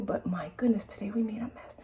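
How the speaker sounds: breathy whispered aside. Real, not acted. Owner whispering about the mess while cleaning. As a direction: whisper it over the bird.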